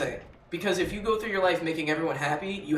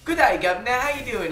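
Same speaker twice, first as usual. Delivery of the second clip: lightly hammed-up British greeting, pitched higher; mock British accent; 7 semitones higher, 5 dB louder than usual. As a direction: imitate a chirpy British "governor" accent. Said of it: "I've known people who are like".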